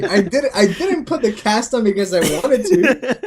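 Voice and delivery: high-pitched, wounded mock protest; squeaky outrage with a laugh underneath; retold indignant outburst. To the friend who called him crybaby, indignant.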